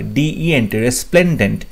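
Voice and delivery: ordinary talk, nothing marked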